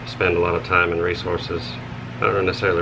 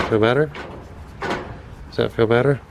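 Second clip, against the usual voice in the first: pet talk; 4 semitones higher than usual. Real, not acted. Cooing while scratching goat.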